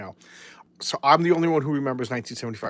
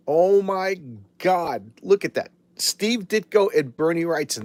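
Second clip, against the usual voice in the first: amazed; 3 semitones higher, slower than usual. Real, not acted. Awed by the artist credits.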